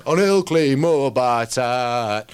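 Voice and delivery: very slow, drawn-out Yorkshire; Yorkshire dialect. Shown as a demonstration. Poet gives host an example of dialect, broad Yorkshire. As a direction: chant it long in broad Yorkshire. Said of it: "the Yorkshire dialect".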